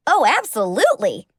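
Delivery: bright, eager burst of help. Acted. Apprentice eagerly answering her idol, excited. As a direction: jump at the chance to help her.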